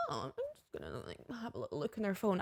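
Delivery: hushed, sneaky inner voice; sneaky quiet voice. Retold or imitated. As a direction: say it quietly like you're plotting. Retold sneaky thought.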